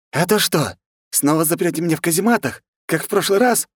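high-pitched protest from a monk at his limit; indignant outburst. A narrator's reading. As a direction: snap indignantly, voice jumping right up. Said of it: "не выдержал он". (возмущённо, срываясь на высокий голос)

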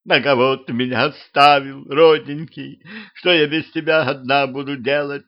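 narrated tearful wail, pitch held up; tearful lamenting. A narrator's reading. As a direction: lament like a wife being left behind. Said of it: "По-женски подвывая, она причитала".

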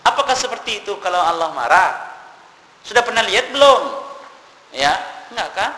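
loud, high, forceful sermon voice; fiery preaching. Acted. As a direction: thunder the challenge at the congregation. Preacher challenges audience again. (khotbah berapi-api, suara keras dan tinggi)

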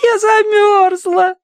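high, slow sobbing wail; crying shout. A narrator's reading. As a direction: wail it through tears. Crying child continues.

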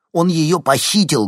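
heated exclamation. A narrator's reading. Boy urging the reporter, heated and loud. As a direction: blurt it out hotly. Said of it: "горячо воскликнул Никита".

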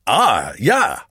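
loud, high character voice; German husband chiming in, loud and high; Herr Vogel. A narrator's reading. Vogel agrees with Roux, loudly.

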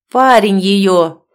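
loud, slow shout from the other room; yelling. A narrator's reading. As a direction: yell it from behind the door. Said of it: "орёт".

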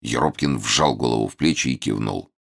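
plain reading voice

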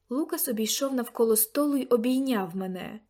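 usual speaking voice

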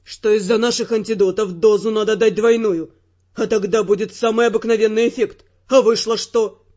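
low, loud male fury; angry Theo. Acted. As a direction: keep hammering him, indignant man. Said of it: "разгневанный голос Тео".

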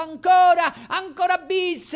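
crowd yelling. Retold or imitated.

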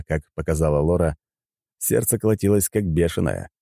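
ordinary delivery

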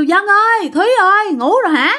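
high, drawn-up name calls; calling out. Acted. Man outside calling the two sleepers, calling out. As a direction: call out to rouse people indoors. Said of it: "Sau đó ông Phước còn kêu".

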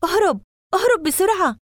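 high, loud panicked shout; terrified yell. A narrator's reading. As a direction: scream at them to flee. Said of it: "بصوته الأجش العالي المرعوب".

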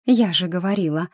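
ordinary delivery, nothing marked

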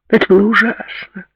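high-pitched, theatrical lament; wailing socialite complaint; dramatic moan. A narrator's reading. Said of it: "простонала Ширли".

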